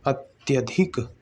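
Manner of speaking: अत्यधिक said slowly and softly, syllable by syllable; slow demo word. Shown as a demonstration. Teacher showing students how the sandhi word sounds, slow and soft. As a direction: draw out अत्यधिक gently. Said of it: "ये हो जाएगा".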